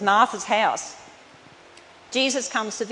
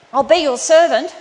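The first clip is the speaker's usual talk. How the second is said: loud pleading offer; 4 semitones higher, 10 dB louder than usual. Retold or imitated. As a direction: cry out the plea to serve, loud. Son's offer, quoted loudly.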